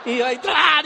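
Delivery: loud jolting exclamation; sudden loud comic outburst; comic burst. Retold or imitated. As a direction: burst out like you just woke.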